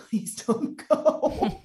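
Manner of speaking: slow, high, laughing plea. Retold or imitated. New mother begging husband not to leave, slow laughing plea. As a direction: beg him half laughing.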